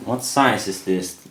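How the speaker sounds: ordinary delivery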